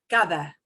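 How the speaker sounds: Cada said kah-tha, the d soft like th. Shown as a demonstration. Teacher modelling cada for students. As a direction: give Cada a th in the middle. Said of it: "sound like a straight out T H".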